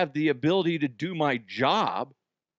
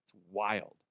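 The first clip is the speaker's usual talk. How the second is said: mumbled aside; 4 semitones lower, 6 dB quieter than usual. Retold or imitated. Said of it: "And then they're like".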